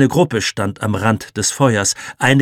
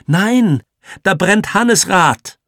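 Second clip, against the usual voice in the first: protest; 10 semitones higher, 6 dB louder, slower than usual. A narrator's reading. Boy protests to Achim.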